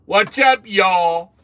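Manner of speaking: high, loud Southern "What's up, y'all?"; practicing southern greeting. Shown as a demonstration. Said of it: "I'm gonna have to get practice saying that".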